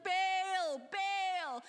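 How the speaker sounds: high, loud pleading cries to a god; frantic calling out. Acted. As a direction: beg Baal loudly, over and over. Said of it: "They're like".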